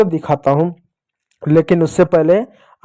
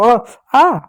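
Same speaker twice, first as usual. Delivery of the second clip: higher playful आ आ; mock pain cry; 5 semitones higher than usual. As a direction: cry out as if being hit. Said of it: "कोई मारता". (मार पड़ने पर नकली चीख)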